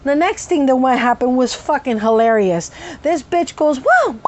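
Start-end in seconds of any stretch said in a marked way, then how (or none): 3.8-4.2 sudden high outburst; involuntary yelped "whoa"; vocal tic yelp. Real, not acted. Tic interrupting her story, high yelp. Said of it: "I'm sorry".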